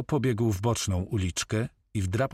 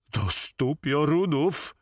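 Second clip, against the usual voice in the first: high, drawn-out oath; cried out; 6 semitones higher, slower than usual. A narrator's reading. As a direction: exclaim it high and slowly. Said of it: "krzyknął Kahma".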